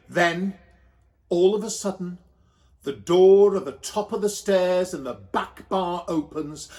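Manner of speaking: ordinary delivery, nothing marked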